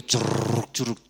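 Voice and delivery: rolled, spluttering gush; imitated diarrhoea noise. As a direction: imitate bowels emptying with a rolling splutter. (imitando el chorro de la diarrea)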